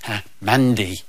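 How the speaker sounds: lightly voiced child, low and small; shy little girl. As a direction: answer timidly as a young volunteer. Little girl answers the magician.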